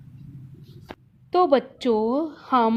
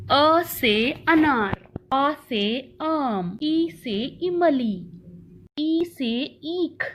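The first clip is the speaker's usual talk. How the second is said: slow teaching recital; much slower than usual. Shown as a demonstration. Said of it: "स्वर को चित्र के साथ पढ़ेंगे".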